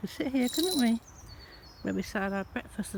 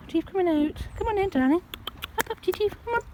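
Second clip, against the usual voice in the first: very high cooing pet voice; coaxing the cats; 12 semitones higher, 3 dB louder than usual. Real, not acted. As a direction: sweet-talk the cats out, high and sing-song. Coaxing cat out.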